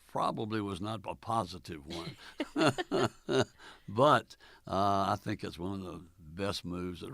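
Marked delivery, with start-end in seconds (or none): 2.2-3.8 a short real laugh at himself; chuckle, embarrassed but amused; hearty laugh. Real, not acted. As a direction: laugh it off, amused at his first reaction. Laughs at his own reaction.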